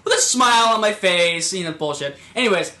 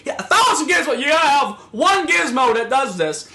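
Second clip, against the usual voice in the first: put-on insistent old guy; 6 semitones higher, 3 dB louder than usual. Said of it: "that's what he said".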